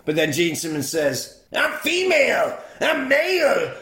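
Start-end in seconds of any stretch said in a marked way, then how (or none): 1.6-3.7 high-pitched hammed-up villain boast, climbing at the end; ranting villain. Villain boasting to the hero, high hammed-up rant. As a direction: crow it in a put-on high voice.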